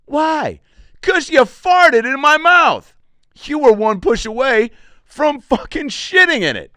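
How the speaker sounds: high, loud, slow disgusted yelling; hammed-up outraged shriek at the wife; mock outraged rant. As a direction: rant at her like she did the unthinkable. Acted outburst at wife.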